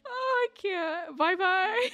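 high, slow fake crying farewell; hammed-up teary goodbye; laughing through a fake sob; mock sobbing. Mock mourning her husband's death.

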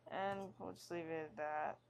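ordinary talk

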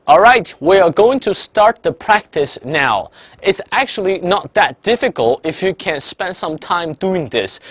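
slow, deliberate English for students to repeat; slow shadowing read. Shown as a demonstration. Teacher reads English slowly so students can shadow, slow and deliberate. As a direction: pace each phrase so they can echo. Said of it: "但你比我慢个半拍".

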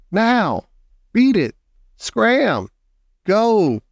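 high, punchy one-word commands; yelling. A narrator's reading. Jack chases the octopus from the mini-sub, yelling. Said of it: "Jack yelled at it".

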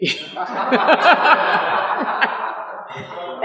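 long breathy burst of laughter; real laughter, unvoiced. Real, not acted. Laughing at being compared to Hitler.

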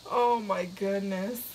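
usual speaking voice

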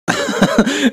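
high, loud burst of laughter; incredulous laugh. Real, not acted. Commentator reacting to the expert's struggle, incredulous laugh.

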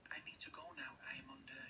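hushed spy whisper, sneaking off; secret whisper. Acted. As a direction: whisper the goodbye like someone being watched. Camel leaving under investigation.

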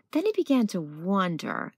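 usual speaking voice

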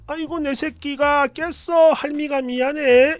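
high, slow granny cooing; soothing grandmother. Retold or imitated. Grandmother comforts crying grandchild, high slow soothing. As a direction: soothe the baby with long drawn-out syllables. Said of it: "이러면서 달래주고 있는데".

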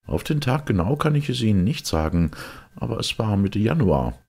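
slightly keyed-up character voice; time traveller. A narrator's reading. Time traveller answers narrator.

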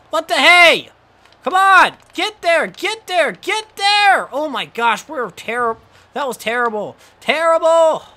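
high, loud urging that turns to disgust; begging the fielder, then groaning at the mess; frustrated yelling. Real, not acted. Fielder too slow, frustrated.

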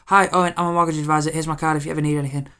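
high, loud and fast canned greeting; hammed-up chirpy saleswoman; rushed robotic pitch. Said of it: "just stepped up and just went".

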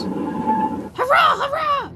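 very high squealing cheer; gleeful trucks cheering. Acted. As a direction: squeal it like naughty runaway trucks. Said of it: "they laughed".

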